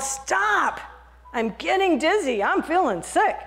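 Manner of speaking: high shouted stop; yell. Acted. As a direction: yell it out, dizzy and sick. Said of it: "I yelled".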